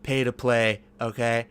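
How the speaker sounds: lightly voiced Trump bluster; a hint of Trump showmanship; Trump impression. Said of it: "I'm losing the accent now".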